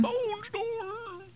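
high hammy battle cry; mock boss yell. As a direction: shriek it like a raid boss. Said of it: "the guy who yells".

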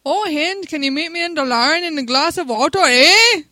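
loud, high, hammed-up Canadian accent. Shown as a demonstration. Asking Hind to meet in Dalaran, hammed-up Canadian accent. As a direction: belt out a mock Canadian with a big final eh. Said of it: "Do a Canadian accent like you're talking to Hind".

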